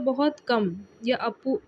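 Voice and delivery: ordinary delivery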